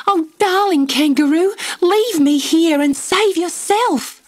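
put-on high, pleading child's voice, slowing; tearful pleading. A narrator's reading. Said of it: "Again Dot cried".

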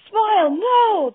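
high, drawn-out loud shriek; anguished scream to a pet. A narrator's reading. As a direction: shriek it in horror, stretched slow. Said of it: "the boy shrieked".

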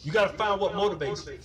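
ordinary talk, nothing marked

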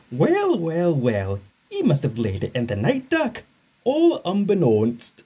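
lightly Scottish sly teasing; a sleepy, sly Scottish tease; Scottish Donald teasing. Acted. Donald teases Duck about the egg, Scottish.

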